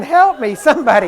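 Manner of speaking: high, loud mock cry for help; imitated screaming. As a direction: cry out like a hammed-up victim. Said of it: "it's like she's screaming".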